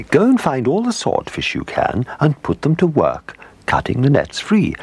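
high-pitched royal command; impatient queen. A narrator's reading. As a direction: give orders as an impatient queen, pitched well up. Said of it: "said the Queen impatiently".

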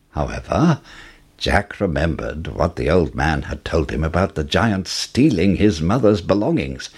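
ordinary delivery

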